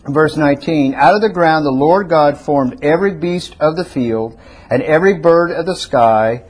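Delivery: ordinary talk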